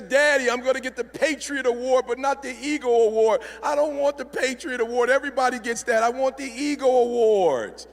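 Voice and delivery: put-on high, whining child voice; mock heartbroken wail, stretched at the end; devastated daughter. Daughter telling Daddy about the award, devastated. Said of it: "She's devastated".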